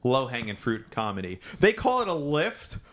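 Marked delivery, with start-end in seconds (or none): 1.6-2.8 slow, high-pitched overdone astonishment; mock amazement. Mocking Americans who hear foreign words, overdone amazement. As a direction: gush slowly in exaggerated disbelief, half laughing.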